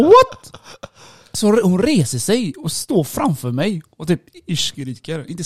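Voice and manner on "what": shrill-high, loud mock shock; shocked disbelief. Man retelling her sudden fury, shocked and incredulous. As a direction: re-enact a loud, high-pitched "what".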